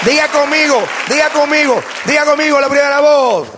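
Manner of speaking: high shouted declarations with held endings; proclamación a gritos. Real, not acted. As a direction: lead the crowd, stretching each last word. Leads congregation in shouted declarations. (proclamación aguda y sostenida a gritos)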